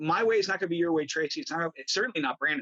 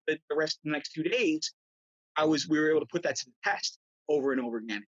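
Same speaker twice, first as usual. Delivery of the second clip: ordinary talk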